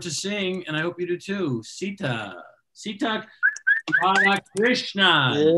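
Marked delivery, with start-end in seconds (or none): 1.6-5.6 "Radhakrishnan" said very slowly, syllable by syllable; careful name pronunciation. Shown as a demonstration. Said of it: "Is that close?".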